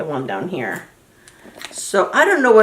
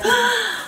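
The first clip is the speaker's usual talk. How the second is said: 1 surprised gasp. Retold or imitated. Reliving opening the box.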